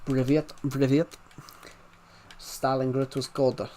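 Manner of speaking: put-on Russian accent. Shown as a demonstration. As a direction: play a Russian greeting someone. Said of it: "I like Russian accents".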